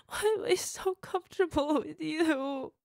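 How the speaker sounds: high, loud sobbing wail. Acted. Partner sobbing that only her lover brings her ease, wailing. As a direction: wail it through tears.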